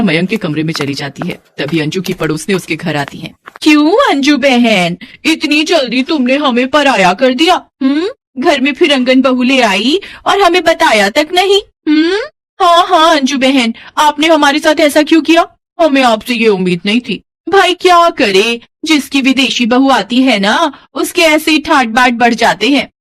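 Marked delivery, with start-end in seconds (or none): 3.6-17.2 taunting neighbour. Acted. 17.5-22.9 high, slow sarcastic drawl; mocking gossip. Neighbour mocking foreign bride.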